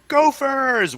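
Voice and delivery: shouted high and loud; loud high group yell; yelled catchphrase. Retold or imitated. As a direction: yell it out like the whole crew. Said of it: "everybody yells out".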